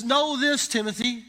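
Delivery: loud, high, urgent charge to Timothy; emphatic. Retold or imitated. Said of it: "it's a very emphatic".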